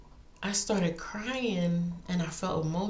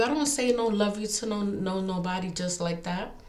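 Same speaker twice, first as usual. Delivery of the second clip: usual speaking voice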